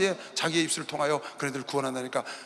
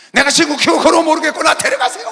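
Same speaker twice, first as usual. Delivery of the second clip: louder, higher, half-laughing angry cry; angry outburst; 9 semitones higher, 11 dB louder than usual. Acted. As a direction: throw Jonah's tantrum at God with a laugh. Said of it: "막 화가 났어".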